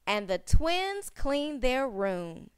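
usual speaking voice

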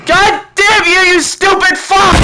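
very high, loud, drawn-out scream; furious yell. Real, not acted. Player curses Foxy for the loss, furious yell. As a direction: scream a curse in real frustration. Cursing Foxy after losing.

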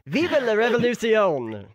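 hammed-up rebel yell, falling at the end. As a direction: cry it out like a rallying revolutionary. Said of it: "and yell".

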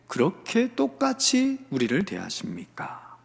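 acted grievance, drawn out; resentful complaint. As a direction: let the protest sink into wounded reproach. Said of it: "원망하며 나아간 것입니다".

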